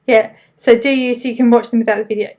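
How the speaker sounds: ordinary delivery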